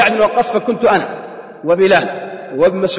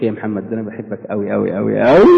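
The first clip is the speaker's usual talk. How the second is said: lower, mock Egyptian sweet talk; Egyptian dialect; 9 semitones lower than usual. Wife sweet-talks her husband in an Egyptian accent. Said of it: "مرة بالمصري".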